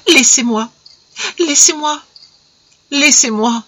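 supplie plus haut et plus fort, au bord des larmes; en larmes, balbutiant. A narrator's reading. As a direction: se débat en répétant laissez-moi. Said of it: "les yeux pleins de larmes et balbutiant". (Irène en larmes repousse Jacques, balbutiante)

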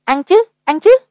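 high-pitched, keen yes; eager reply. A narrator's reading. Hungry woman answers whether she will eat breakfast, eager.

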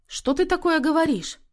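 high-pitched reproach; reproachful. A narrator's reading. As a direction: chide her in disbelief. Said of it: "упрекнула её Тоня".

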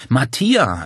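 laut und hoch herausgeplatzt; überraschter Ausruf. A narrator's reading. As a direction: den Namen verblüfft ausstoßen. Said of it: "entfuhr es Claudia und Paul fast gleichzeitig". (verblüfft)

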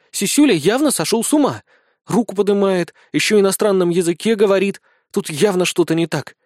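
mildly indignant, high-pitched complaint. A narrator's reading. Small blonde argues the sergeant went mad, indignant. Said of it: "возмутилась Безос".

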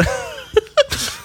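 high, breathy giggle; laughing. Real, not acted. Host cracking up mid-question, high breathy laugh. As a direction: break into a high, voiceless laugh.

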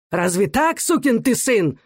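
high, indignant squeal. A narrator's reading. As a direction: squeal the rebuke, pitch climbing. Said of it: "взвизгнул Становой".

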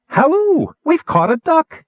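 high, loud gnome hollering; gleeful shout. A narrator's reading. Gnome shouting to crew about the duck, excited high yell. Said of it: "he shouted".

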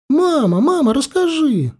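put-on be kids begging mum for a story; children in the boat begging mother, high and eager; children shouting. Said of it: "закричали хором дети".

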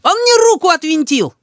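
very high, shrieked complaint; high-pitched kid's protest; child wailing. A narrator's reading. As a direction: wail like a hard-done-by little boy. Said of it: "вопит, брыкаясь, Рис".

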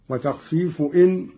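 normal talking voice

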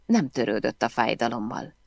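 ordinary delivery

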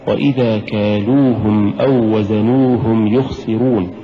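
slow chanted tajweed recitation; Quranic recitation. Acted. Teacher recites the verse as a model. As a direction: intone the verse slowly, in measured phrases.

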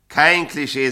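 lauter und höher, mit Nachdruck; nachdrücklich wiederholt. Acted. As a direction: bestehe darauf, dass es ankommt. Wiederholt mit Nachdruck, "sage ich. (nachdrücklich und lauter wiederholt)

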